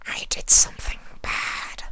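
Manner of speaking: a seductive hushed question; whispering changeling. Acted. Sympathy whisper voice.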